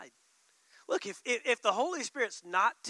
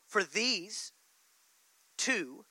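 usual speaking voice

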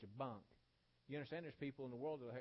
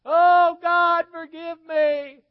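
huge high-pitched holler, words stretched out in anguish; hollered wailing; 31 dB louder, much slower than usual. Retold or imitated. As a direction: imitate a woman hollering in torment at full volume. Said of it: "hollering that all day long".

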